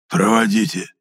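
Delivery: choked, hoarse retort; hoarse wheeze. A narrator's reading. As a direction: force it out through a squeezed throat. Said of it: "прохрипел Митрофан".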